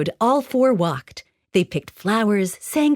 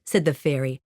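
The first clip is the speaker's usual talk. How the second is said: ordinary delivery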